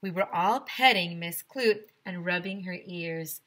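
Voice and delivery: ordinary delivery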